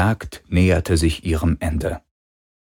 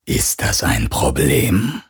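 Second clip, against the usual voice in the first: lower, slow alien hunter voice; menacing hunter; 5 semitones lower, slower than usual. Acted. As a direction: ask it cold and slow. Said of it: "fragte Gauros".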